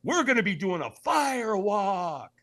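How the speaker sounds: buddy on the phone, saving the big news for last, rising excitement; excited phone news. Retold or imitated. Dan excitedly adds fire walk news.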